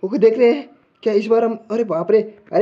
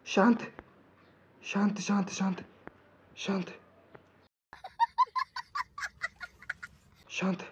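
much softer, breathy near-whisper of calm; hushed self-calming; 15 dB quieter than usual. Real, not acted. Gamer hiding from the monster, hushing himself almost silently. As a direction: hush yourself while hiding.

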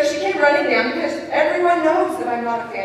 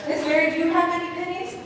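put-on child's question; 7 semitones higher, faster than usual. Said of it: "Noah says".

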